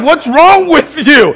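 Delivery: high, loud, drawn-out question; acted alarmed demand. Daughter questioning her yelling father, acted alarmed shout. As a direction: call it out in mock alarm.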